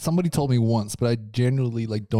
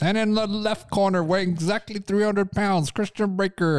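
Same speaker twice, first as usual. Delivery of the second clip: high hyped ring announcer; wrestling announcer voice; 7 semitones higher than usual. Retold or imitated. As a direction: boom it out like a ring intro. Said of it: "wrestling voice".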